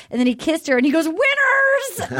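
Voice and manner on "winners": high, drawn-out victory cry; mock an awkward victory scream; whooping it far too high; mock triumphant scream. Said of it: "such an awkward thing to scream".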